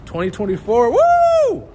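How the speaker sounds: high falsetto woo and laugh; excited cheer. Real, not acted. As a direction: whoop it up with joy. Cheering for the new year.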